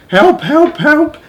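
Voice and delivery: mildly high rising cries; a hint of grandmother calling. Miss Spider imitating her stuck grandmother calling. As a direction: lightly call help from afar. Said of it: "calling to us".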